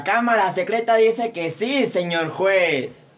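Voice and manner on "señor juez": ordinary talk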